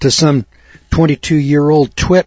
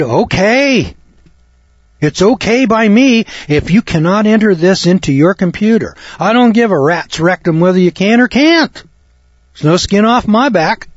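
higher and cocky, brushing her off; a cocky comeback that dismisses the clerk; defiant retort; 6 semitones higher than usual. Retold or imitated. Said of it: "Now listen to the conversation again".

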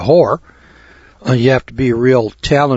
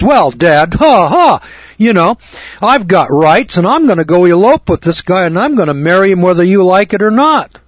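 higher, louder mock-defiant daughter; uppity daughter; 6 semitones higher, 4 dB louder than usual. Daughter tells Dad she will elope, mock uppity defiance. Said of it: "my daughter gets a little uppity".

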